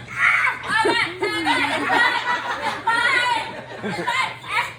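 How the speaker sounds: high, loud scrambling ah cries; frantic yelling. Acted. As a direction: yell like kids elbowing for the phone. Siblings yelling fighting for phone.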